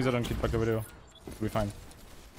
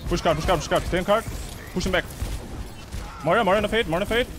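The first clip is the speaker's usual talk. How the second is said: loud, higher urgent commands; shouted callouts; 6 semitones higher, 3 dB louder, faster than usual. Real, not acted. Calling the push to teammates, urgent shouting. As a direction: bark orders at the team.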